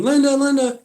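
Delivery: high, loud taunting call; mocking call. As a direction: yell the name back to make her mad. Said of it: "And I'm going".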